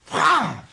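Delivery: high imitated ape screech; monkey shriek. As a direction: screech like the biting chimp.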